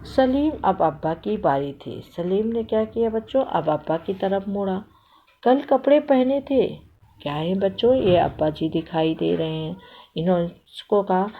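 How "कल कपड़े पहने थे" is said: high-pitched mock-parental remark; mimicking the grown-ups. A narrator's reading. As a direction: play a child copying a nagging elder. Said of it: "दोनों बच्चे बड़ों की नकल उतार रहे थे". (बड़ों की नकल वाला ऊँचा स्वर)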